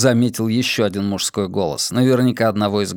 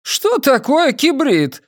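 high, slow thug voice, almost a woman's; high, almost female voice; 3 dB louder, slower than usual. Acted. Dim henchman asking a question, high almost female voice. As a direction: ask it in a thin, girlish pitch. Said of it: "высокий, почти женский голос".

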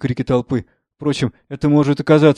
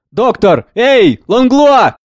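loud, raised call into the distance; shouting; 10 semitones higher, 11 dB louder, much slower than usual. A narrator's reading. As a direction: call out for the missing doctor, stretching the name. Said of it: "Морис и шофёр кричат". (громко зовёт, кричит)

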